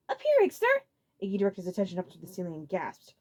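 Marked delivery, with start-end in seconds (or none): 0.1-0.8 Larry voice. A narrator's reading.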